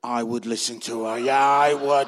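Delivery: low, booming divine aside; God's voice. Retold or imitated. God advises husband facing his angry wife, low and knowing. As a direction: start low, then boom out the confirmation. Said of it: "God said".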